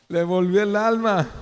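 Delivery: a preacher exclaiming the king's relief; relieved exclamation. Shown as a demonstration. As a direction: burst out with relief on alma.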